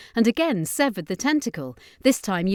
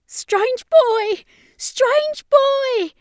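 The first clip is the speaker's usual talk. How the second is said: much higher, loud desperate calling; screaming; 9 dB louder, much slower than usual. Acted. Ashley calls for the strange boy, frantic scream. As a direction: scream the name into the dark. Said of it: "she screamed".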